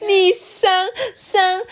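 high, stretched-out stammering wail; loud bawling. Acted. Rourou hears his ears called cute and bawls, wounded. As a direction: bawl it like an offended crybaby. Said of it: "大哭着说".

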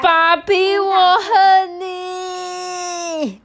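high, loud crying wail, drawn out; son wailing. Acted. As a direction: wail at dad in tears. Son learns he got ballet.

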